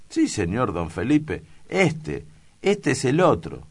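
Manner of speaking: loud, high pointing-out; insistent accusation. Acted. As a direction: jab the finger: this is the man. Priest insists, pointing at clerk.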